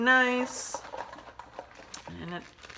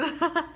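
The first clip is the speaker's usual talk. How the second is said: short laugh; 8 semitones higher than usual. Real, not acted. Laughing at own remark.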